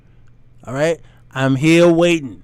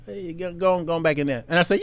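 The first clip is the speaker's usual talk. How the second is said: ordinary talk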